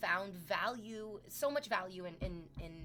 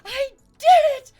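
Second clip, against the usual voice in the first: gasp, then high squealing shout; hammed-up, ecstatic high-pitched yell; overjoyed disbelief; 23 dB louder, slower than usual. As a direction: shriek it like you can't believe your triumph. Retells own thrilled reaction.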